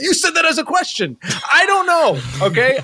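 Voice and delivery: high-pitched, indignant retort; exasperated outburst. Acted. Host arguing with his meddling friend, high and exasperated. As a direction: throw it back, thoroughly fed up. Acted quarrel, snapping back at friend.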